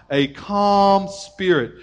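slow, calm and drawn out. Shown as a demonstration. Preacher showing calm to moms, slow. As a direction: stretch it out calmly. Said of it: "God-given D N A of bringing out".

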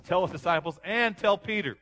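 high, loud divine command; loud commanding voice of God; the Lord calling out. Acted. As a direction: call it out like the voice of the Lord.

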